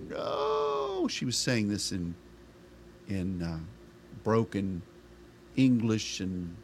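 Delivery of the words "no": weeping cry. Retold or imitated. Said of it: "She started weeping".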